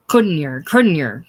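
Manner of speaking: a furious smith taunting the clerk; angry cry. A narrator's reading. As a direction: jeer it at him with clenched fists. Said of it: "cried Dawes".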